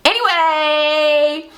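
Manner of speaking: very slow, stretched singsong word; drawn-out segue. Real, not acted. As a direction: stretch it out to change the subject. Drawn-out switch to sign-off.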